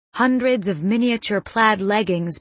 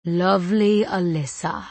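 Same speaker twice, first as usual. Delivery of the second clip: put-on Cockney accent. A narrator's reading. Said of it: "The guy's lips purr that Cockney accent".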